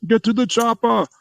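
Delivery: high, strained mock-Austrian hero; hammed-up action-hero cry; Arnold. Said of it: "I have to work on that voice".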